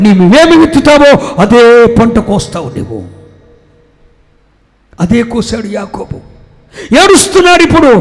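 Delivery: loud, high, impassioned sermon; preacher in full flight; fiery preaching. Acted. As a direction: thunder it at the congregation.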